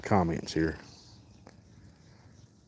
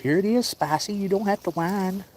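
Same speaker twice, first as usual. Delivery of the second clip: high pet talk; 11 semitones higher than usual. Real, not acted. Man coaxing his whining cat Spicy, high baby talk.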